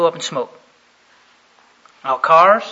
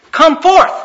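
loud, high preacher's command; commanding call; 10 semitones higher, 12 dB louder than usual. Retold or imitated. Jesus calling to every tomb at once, commanding call. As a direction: boom it out to all the tombs. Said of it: "walked up to the tombs and said".

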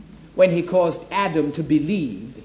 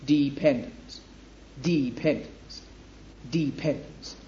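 dependence said three times, each softer and slower, letting "dependence" sink in; stressed key word; 3 semitones lower, 7 dB quieter than usual. Shown as a demonstration. Preacher driving home "dependence" to the listeners, fading repetitions. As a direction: repeat "dependence" quieter each time. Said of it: "this is the relevant word".

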